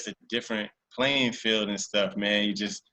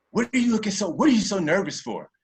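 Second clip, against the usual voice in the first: cop questioning; 9 semitones higher, 4 dB louder than usual. Retold or imitated. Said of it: "he's like".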